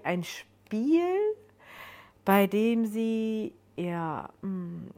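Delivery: drawn-out, searching, unfinished thought; hesitant recalling. A narrator's reading. Joan trying to recall a drunken night, hesitant. Narrator trails through Joan's hazy memory.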